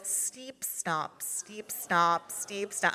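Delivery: nose pinched shut; blocked nose. Shown as a demonstration. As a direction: say it holding your nose. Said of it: "Plug your nose".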